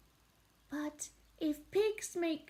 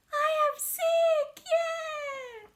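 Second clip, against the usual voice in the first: joyful shout; 12 semitones higher, 5 dB louder, slower than usual. Acted. Gerald relieved joy.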